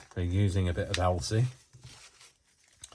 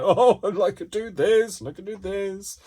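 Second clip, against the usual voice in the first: excited inner voice; 12 semitones higher, 7 dB louder than usual. Retold or imitated. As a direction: squeal it like a sudden bright idea. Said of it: "my mind is like".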